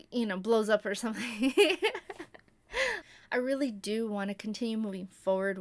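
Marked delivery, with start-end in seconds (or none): 1.6-2.3 high laugh. Real, not acted. Laugh after joking about YouTube.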